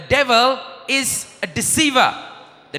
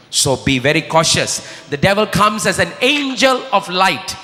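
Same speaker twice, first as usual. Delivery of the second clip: ordinary delivery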